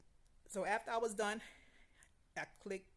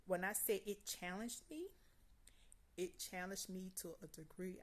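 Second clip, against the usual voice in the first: normal talking voice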